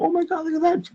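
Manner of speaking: high excited tourist gasp, imitating; mock the wide-eyed hotel guests; gawking tourist. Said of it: "just kinda go".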